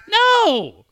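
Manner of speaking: high, loud, drawn-out refusal; mock protesting cry. Son answering dad's teasing, mock protesting cry. Son's retold horrified answer.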